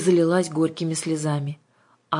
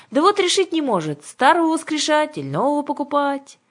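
high, loud witch's complaint; loud, high-pitched grumble; witch Aga, exasperated; 12 semitones higher, 6 dB louder than usual. Acted. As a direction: explain with theatrical impatience.

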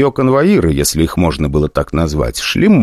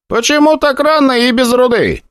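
high ringing voice. A narrator's reading. Brigade leader questioning the guards, high and ringing. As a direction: ring it out high. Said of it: "звонким и высоким голосом".

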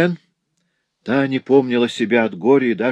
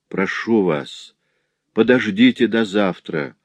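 ordinary delivery

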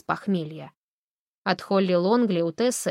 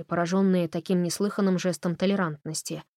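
usual speaking voice

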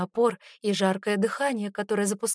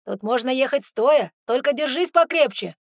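loud, higher call from the wheel; 4 semitones higher, 8 dB louder than usual. A narrator's reading. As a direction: call out over the engine. Yaroslav calls from the jeep.

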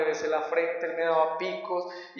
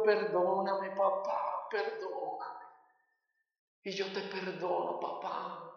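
softer and softer, almost whispered; tearful whisper; 7 dB quieter, slower than usual. Retold or imitated. Father and son embracing at the prison door, hushed. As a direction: fade to a breath of forgiveness.

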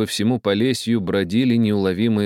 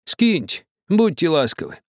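high, coaxing plea; old man pleading; 10 semitones higher than usual. A narrator's reading. Old man begging the captain, high and coaxing. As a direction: beg him kindly. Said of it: "ответил старик".

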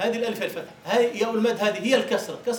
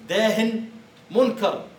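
"داه" and "منكر" louder and higher, each word on its own; emphatic quote; 5 semitones higher, 6 dB louder, slower than usual. Shown as a demonstration. Teacher repeating the old phrase to students, louder and emphatic. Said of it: "يقولون في الكلام القديم".

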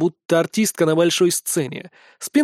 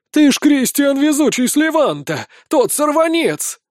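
excited recognition; 8 semitones higher, 3 dB louder than usual. A narrator's reading. Crowd recognising the famous rascal, excited cry. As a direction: join in the cheer, pitched well up. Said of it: "подхватили другие".

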